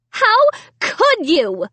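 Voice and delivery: high, slow outraged cry; screaming. A narrator's reading. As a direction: scream it again. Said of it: "Sarah screamed".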